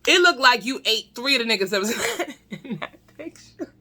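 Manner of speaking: fast roast broken by a laugh; laughing retort. Retold or imitated. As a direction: fire off the insult and crack up mid-line. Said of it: "And I'm like".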